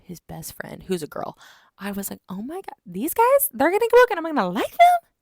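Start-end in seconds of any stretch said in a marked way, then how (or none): 2.3-5.1 high, loud incredulous squeal; mock outrage. Reader objects to liking these guys, mock outrage. Retells own outraged reaction to brothers.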